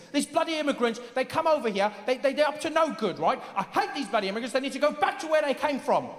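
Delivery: high, aggrieved British voice; British anti-immigrant ranter. Retold or imitated. Brexit voter complaining about immigrants, high indignant rant. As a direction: rant in a worked-up British accent. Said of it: "People saying the weirdest things".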